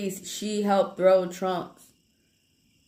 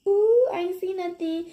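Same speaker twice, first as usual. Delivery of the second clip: much higher, playing dumb; mock Trump innocence; 12 semitones higher than usual. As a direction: pretend you saw nothing, coy. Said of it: "If I was Trump, I would be like".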